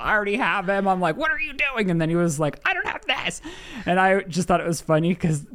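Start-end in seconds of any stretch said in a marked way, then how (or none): 1.2-1.9 high-pitched mock scream, no real volume; retold screaming. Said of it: "kind of screaming at him". 2.6-3.4 very high panicked protest, mimicked; mock yell without the volume; imitated yelling. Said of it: "he's kind of yelling at me".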